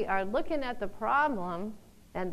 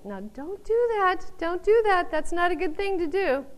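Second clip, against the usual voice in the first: scolding critics; 8 semitones higher, 4 dB louder than usual. Retold or imitated. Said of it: "discouraged by other people".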